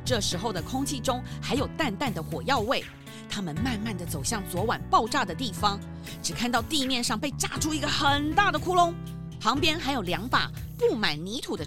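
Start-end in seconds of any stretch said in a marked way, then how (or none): none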